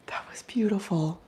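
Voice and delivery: whispering. Real, not acted. Awed by owl.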